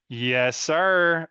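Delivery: a quick Texan drawl on Yes, sir; Texan accent. Acted. Giving VR feedback, slips into a Texan accent. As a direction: slip into a country Texas accent. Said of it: "I keep slipping to this accent".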